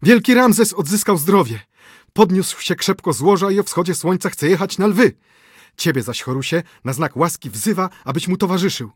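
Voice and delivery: high, loud breathless news; excited announcement. A narrator's reading. Messenger announces Ramses has recovered, high excited voice. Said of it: "zawołał przybyły".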